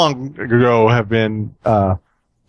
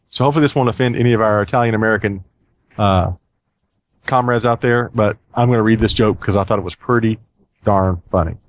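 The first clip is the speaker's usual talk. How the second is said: ordinary talk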